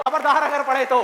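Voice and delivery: loud acted threat; mock-threatening shout; shouted warning. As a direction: warn them off at full voice. Acts out world threatening the student.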